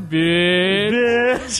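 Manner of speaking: drawn-out lazy beach with a laugh; slow lazy bitch. Pretend. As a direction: stretch "Bitch" lazily, laughing. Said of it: "tu fica mais lento". (beach arrastado e preguiçoso)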